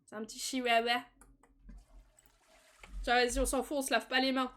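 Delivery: ordinary talk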